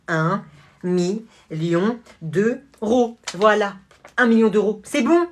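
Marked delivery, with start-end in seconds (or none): 0.1-3.2 very slow, spelled-out amount; slow drawn out. Acted. Buying the school, drawling the sum onto a cheque. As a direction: savour every word as you write.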